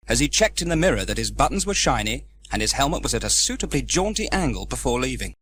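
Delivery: ordinary delivery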